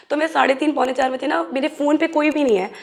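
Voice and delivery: normal talking voice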